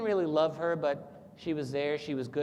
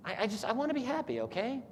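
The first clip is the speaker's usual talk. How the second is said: much higher, fast, pleading; desperate plea; 10 semitones higher than usual. Retold or imitated. Ivan pleading with his soul, high and fast. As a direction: blurt it out, near breaking.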